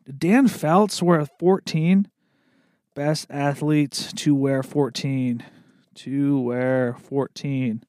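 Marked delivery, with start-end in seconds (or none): 2.9-7.8 slow, soft search-bar reading; slow dictation. Real, not acted. Typing a web search for listeners, read slowly and softly. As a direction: drag the words out softly as you type.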